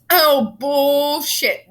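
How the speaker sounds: drawn-out, loud, disgusted dismissal; slow, loud scoff at the excuse; loud scornful oh bullshit. Real, not acted. Host scoffing at the report's excuse, loud and slow.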